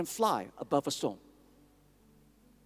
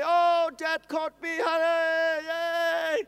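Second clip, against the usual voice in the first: put-on higher, slow, gleeful child's cheer; a toddler squealing with delight; delirious joy; 9 semitones higher, much slower than usual. Son caught by his dad, high gleeful child cheer. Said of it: "delirious with joy".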